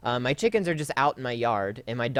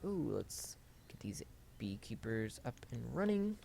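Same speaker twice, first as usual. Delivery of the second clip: breathy whispered aside to herself; half-whispered muttering while playing; 16 dB quieter than usual. Real, not acted. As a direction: drop to a whisper, almost under the breath.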